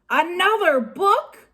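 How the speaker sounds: high slow outraged roar; angry roar. A narrator's reading. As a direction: bellow in disgust. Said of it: "roared Captain Bones".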